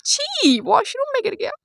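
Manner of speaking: disgusted. Real, not acted. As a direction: recoil at the gross thought. Brother fell in the washroom. (ऊँची आवाज़ में घिन से "छी")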